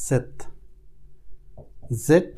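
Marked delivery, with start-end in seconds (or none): 0.1-0.3 সেত with a soft স at the start, like the ছ in ছয়; সেত spoken clearly, one syllable at a time; German Z sound. Shown as a demonstration. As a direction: say সেত slowly, starting with a light স. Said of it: "এর উচ্চারণটা আসে ছয়ের মতো".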